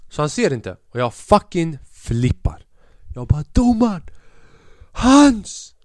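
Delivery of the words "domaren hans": high, loud shout of protest; yelling at the referee. Retold or imitated. Player yelling at the referee, outraged shout. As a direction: shout the appeal at the ref.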